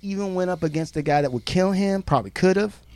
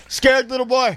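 high mocking taunt; 8 semitones higher, 4 dB louder than usual. Teasing, voice high.

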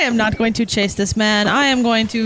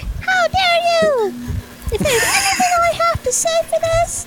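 very high, very slow squeak; tiny squeaky voice. Retold or imitated. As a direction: squeak it out slowly like a tiny sprite. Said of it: "I was just like".

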